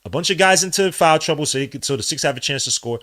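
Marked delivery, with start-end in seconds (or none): none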